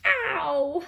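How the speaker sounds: high, drawn-out mock ow; storybook cry of pain; acted ow. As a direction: yelp as if landing hard. Kangaroo lands on her bottom.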